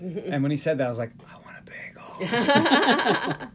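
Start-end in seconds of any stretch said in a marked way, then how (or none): 1.3-3.5 whispered craving. Retold or imitated. Reacting to the film's bagel line, whispered longing.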